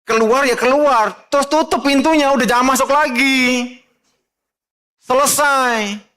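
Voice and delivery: high, loud preacher's call to run; preaching shout. Real, not acted. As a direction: cry it out like a fiery preacher. Preacher urging them to flee. (teriakan khotbah yang keras)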